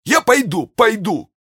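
very high-pitched panicked soldier's voice; frightened soldier. Acted. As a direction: give in fast in a squeaky, scared high voice.

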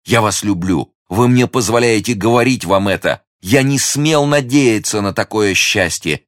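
fervent gallant, lingering at the end; ardent young lover. Acted. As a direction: declare love with breathless joy. Hero declares his love.

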